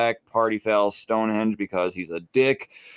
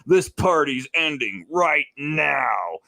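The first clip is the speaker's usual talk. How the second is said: drawn-out, higher commanding announcement; Captain Marvel; 4 semitones higher, slower than usual. Retold or imitated. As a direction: stretch it out like a hero breaking up a party. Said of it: "Captain Marvel comes to Earth and is like".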